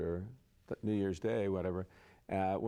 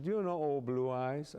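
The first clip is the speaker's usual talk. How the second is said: put-on higher-pitched German-accented queen; imitated German accent, raised pitch; 5 semitones higher than usual. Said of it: "she talked like this".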